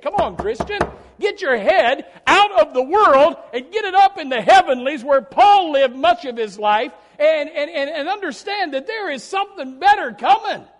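loud, high, impassioned preaching; fiery preaching. Acted. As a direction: preach it loud to lift the congregation. Exhorting the congregation.

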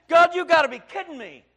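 loud, high anguished protest; crying out to God. Retold or imitated. Preacher retelling his cry to God, loud anguished protest. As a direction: shout it up at heaven.

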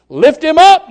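loud, high cry of lift him up; preacher urging the church upward; preaching shout. Acted. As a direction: shout it to the rafters. Preacher exhorts congregation.

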